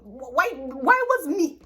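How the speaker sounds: incredulous. Real, not acted. Incredulous rant.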